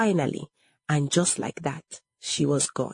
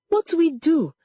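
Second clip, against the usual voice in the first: high, louder cry of alarm; panicked question; 12 semitones higher, 5 dB louder than usual. A narrator's reading. Frightened child asks siblings for help, panicked. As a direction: ask it urgently, pitched right up. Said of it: "Panic entered the room".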